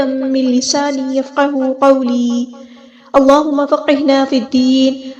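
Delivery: slow, drawn-out Arabic supplication; slow prayer. Acted. Teacher opening the lesson with a slow prayer. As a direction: pray each phrase slowly and devoutly. Opening dua before recitation.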